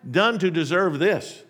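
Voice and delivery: loud, high, aggrieved protest; indignant oyster crying out at injustice; heated complaint. Acted. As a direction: demand to know why me, with heat. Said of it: "the oyster might have said with considerable heat".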